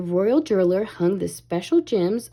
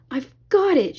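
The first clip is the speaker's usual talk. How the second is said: higher, eager cry of triumph; a touch of a shout; mild shout; 5 semitones higher than usual. A narrator's reading. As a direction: call out as if grabbing it. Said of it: "shouted Ariel".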